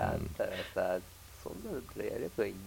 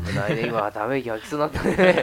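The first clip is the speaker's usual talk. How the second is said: laughing; 3 semitones higher, 12 dB louder than usual. Real, not acted. Almost sighed, joking alarm.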